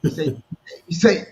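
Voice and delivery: ordinary talk, nothing marked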